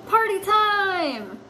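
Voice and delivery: high, slow, drawn-out "Party time!"; excited cheer. Acted. Teacher voicing cats celebrating, high and drawn out. Reading comic aloud, cheer.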